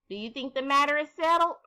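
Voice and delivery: ordinary delivery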